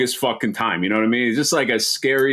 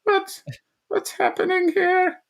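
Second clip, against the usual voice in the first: impression of mom. Host imitating mother seeing the video, high dismayed falsetto. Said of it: "That's my impression of my mom".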